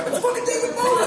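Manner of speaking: very high, loud punchline squeal; amazed realisation. Acted. Listener realising who the friend in the story is, astonished. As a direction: burst out in mock astonishment.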